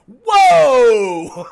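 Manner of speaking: loud high laughing whoa; shocked whoa. Retold or imitated. Dad overhearing his daughter swear, shocked laughing shout. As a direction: blurt it out in shocked amusement. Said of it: "I was just like".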